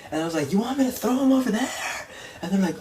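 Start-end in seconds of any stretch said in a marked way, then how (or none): 0.5-2.1 very high, imitated showman's holler; calling to crowd. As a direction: mimic a wrestler shouting to the back rows. Wrestler calls out to crowd.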